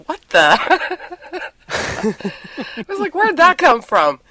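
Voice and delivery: baffled and amused at the discovery; retold astonishment. Retold shock at hearing old tapes.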